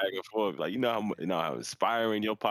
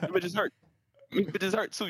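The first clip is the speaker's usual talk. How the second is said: imitated woman complaining; 12 semitones higher than usual. Retelling getting punched.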